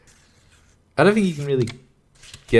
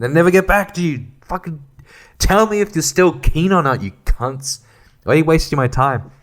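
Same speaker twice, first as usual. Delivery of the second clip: loud, high, fed-up venting at buyers; exasperated rant; 7 semitones higher, 3 dB louder than usual. Real, not acted. Ranting about time-wasting buyers, loud and fed up. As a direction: vent it, voice rising and loud.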